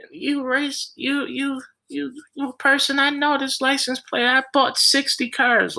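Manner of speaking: mocking high-pitched rant, stumbling at the start; high, sputtering accusation in mimicry; mock screaming. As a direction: imitate an irate woman in a screechy high voice. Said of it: "this lady is screaming at the top of her lungs".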